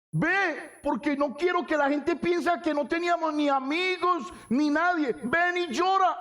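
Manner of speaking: a hint of a pushy relative urging someone to weep; pleading relative. Family hiring mourners voiced.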